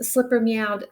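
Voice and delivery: ordinary delivery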